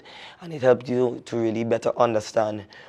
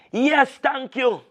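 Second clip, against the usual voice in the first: trembling; 4 dB louder, slower than usual. Acted.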